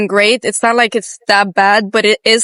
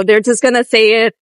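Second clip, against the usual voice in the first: usual speaking voice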